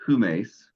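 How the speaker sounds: "humes" with a sounded H; breathed H opening "humes"; aspirated H. Shown as a demonstration. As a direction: say "humes" as hoo-MACE. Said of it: "So if it's cupped".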